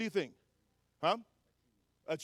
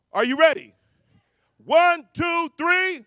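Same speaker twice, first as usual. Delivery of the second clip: hype count; 7 semitones higher, 8 dB louder, slower than usual. Real, not acted. Speaker firing up the ballroom crowd to yell, loud rising count.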